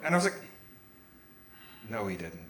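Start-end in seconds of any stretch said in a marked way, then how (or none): 1.9-2.5 low, very quiet contradiction; deadpan denial. Acted. Son-in-law answering the dog attack story, deadpan. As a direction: drop right down and deny it under your breath.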